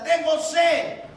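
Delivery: slow, high, loud "Tengo sed"; thirsty shout. Acted. Christ on the cross shouting for water, loud and slow. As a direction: cry out burning with thirst. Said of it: "cuando él grita".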